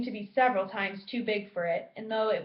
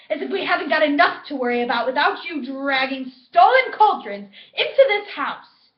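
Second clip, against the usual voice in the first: screaming scolding, much higher and louder; furious shout; 6 semitones higher, 11 dB louder than usual. A narrator's reading. Mother scolding Mundungus for stolen cauldrons, shrieking. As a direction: tear into him at the top of your lungs. Mrs Weasley yelling at Mundungus.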